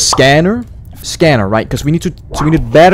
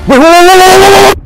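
frantic shout; 11 dB louder than usual. Real, not acted. Spots something, frantic stop.

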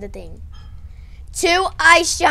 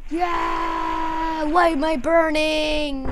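higher, drawn-out mock wail; play-acted alarm; 6 semitones higher, much slower than usual. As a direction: voice a toy figure wailing as it burns. Figure burning in play story.

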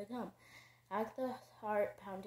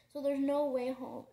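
tearful; 4 semitones higher, 7 dB louder than usual. A narrator's reading. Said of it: "asked, eyes welling".

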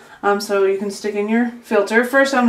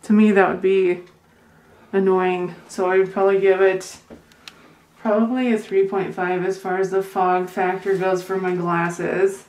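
muffled behind cloth mask; speaking with covered mouth; through a face mask; faster than usual. Real, not acted.

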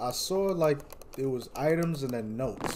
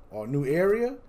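ordinary talk, nothing marked

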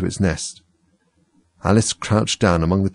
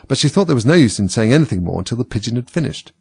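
ordinary delivery, nothing marked

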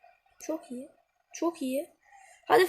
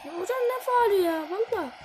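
indignant protest; 7 semitones higher than usual. Real, not acted. Gamer to referee over a foul call, indignant protest. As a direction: argue the call, voice shooting up.

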